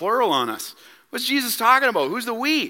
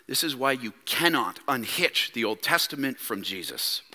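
much softer and lower; hushed solemn aside; 10 semitones lower, 7 dB quieter than usual. Real, not acted. Preacher tells loved ones a truth worth repeating, hushed. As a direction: drop right down for a weighty point.

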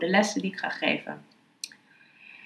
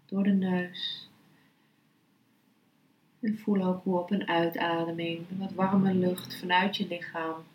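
guided meditation; 3 dB quieter than usual. Acted. (rustige, zachte meditatiestem)

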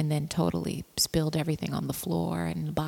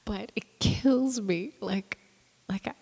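laughing; 3 semitones higher than usual. Real, not acted. Still laughing about show.

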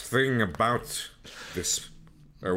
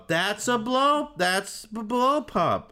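high, slow ad singalong; jingle tag line; 10 semitones higher, slower than usual. Retold or imitated. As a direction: sing the ad's ending. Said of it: "the classic ending where".

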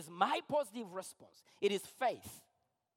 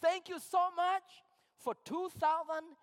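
grateful prayer; 5 semitones higher, 4 dB louder than usual. Pretend. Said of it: "I'm like".

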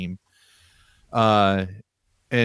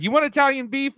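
much higher, lightly voiced girlfriend; imitating the girlfriend; faster than usual. Girlfriend asks the pinball buyer about Italian beef, higher voiced. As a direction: pitch way up for her eager question. Quoting skinny girlfriend's question.

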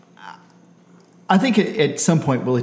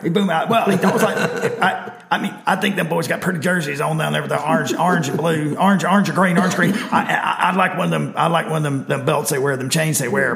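higher, folksy Southern coach with clipped words; Ed Ogeron impression; 4 semitones higher than usual. Host making his game pick as a coach, Ed Ogeron voice. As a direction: ramble and stumble like a comic Southern coach. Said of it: "pick in Ed Ogeron voice".

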